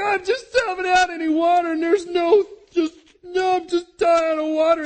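high, loud desperate shout; desperate cry. Retold or imitated. Hagar crying out from thirst, loud high shout.

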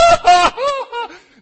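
real high burst of laughter; big laugh; loud laugh. Real, not acted. Pastor laughs at his act.

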